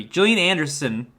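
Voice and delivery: ordinary talk, nothing marked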